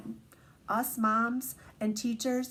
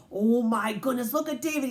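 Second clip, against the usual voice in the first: ordinary delivery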